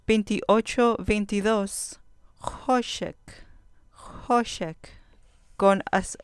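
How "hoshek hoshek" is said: "hoshek" with HO stressed high; stress on penultimate syllable. Shown as a demonstration. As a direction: hit the HO of "hoshek", drop on shek. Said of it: "acento en la penúltima sílaba". ("hoshek" con acento en la penúltima sílaba)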